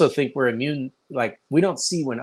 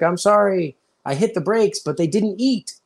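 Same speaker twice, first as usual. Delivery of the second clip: higher-pitched apologetic joke character; mock apology; 8 semitones higher, 3 dB louder than usual. Indonesian driver apologizes after an accident, mock pleading. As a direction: plead innocently, pitched well up.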